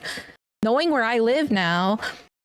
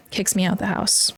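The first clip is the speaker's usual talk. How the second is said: normal talking voice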